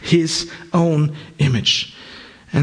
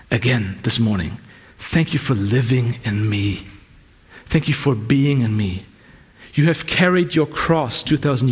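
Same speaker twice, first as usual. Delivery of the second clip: hushed, lower voice of intimate prayer; morning prayer; 3 semitones lower than usual. Retold or imitated. Preacher voicing his morning prayer to Jesus, hushed. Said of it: "It's more".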